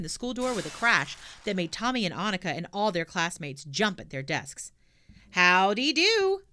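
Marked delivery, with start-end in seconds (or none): 5.3-6.4 loud, slow, drawn-out howdy; bellowed greeting. A narrator's reading. Pippi bursts into class bellowing hello, loud and slow. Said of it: "bellowed Pippi".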